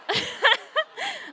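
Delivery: a sudden high laugh; high burst of laughter. Real, not acted. Telling the church about the phone, a high real laugh.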